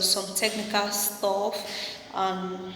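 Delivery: normal talking voice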